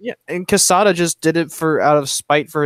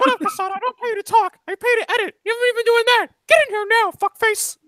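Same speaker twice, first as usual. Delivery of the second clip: high squeaky Mickey scolding; berating cartoon mouse boss; angry boss. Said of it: "do an interaction right now".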